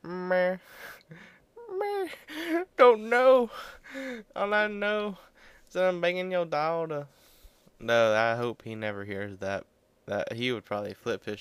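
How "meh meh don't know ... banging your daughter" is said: high, loud goofy mock voice; high silly taunting voice; cheeky reply. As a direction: sass back in a high goofy voice. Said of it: "I'd go".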